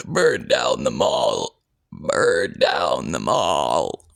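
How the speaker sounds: very low, slow demonic growl; deep demonic voice. Shown as a demonstration. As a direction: drag it out like a monster from the void. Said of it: "or maybe like a".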